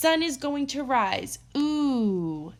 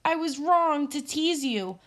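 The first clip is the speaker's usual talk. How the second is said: pleading; 6 semitones higher than usual. Acted. Squirrel pleading pinned down.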